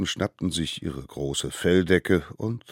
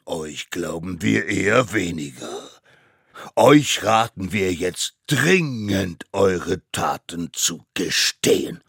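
loud, slow, weighty warning; stern mammoth judge; 10 dB louder, slower than usual. Acted. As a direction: bear down on the culprits, word by word. Court urges confession. (langsam und laut mahnender Richter)

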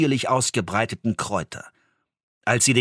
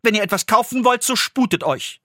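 higher and louder, lightly voiced impatience; impatient mother; 11 semitones higher, 3 dB louder than usual. Hanne urging the old woman to buy, impatient. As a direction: hurry her up, a little sharply. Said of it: "sagte endlich die Frau des Schusters".

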